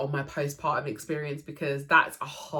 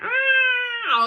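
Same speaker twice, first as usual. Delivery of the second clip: emotional cry; 8 dB louder than usual. Real, not acted. Hearing her newborn son cry.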